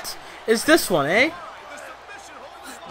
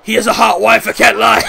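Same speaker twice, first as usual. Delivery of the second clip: blurted out, louder; half-shouting a confession; loud joking admission; loud outburst; 6 dB louder than usual. Real, not acted. Blurting about Triple H's wife.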